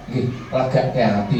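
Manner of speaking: normal talking voice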